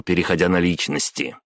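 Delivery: ordinary delivery, nothing marked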